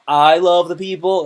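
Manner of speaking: hammed-up fake affection; sarcastic. Shown as a demonstration. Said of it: "that's how you do sarcasm".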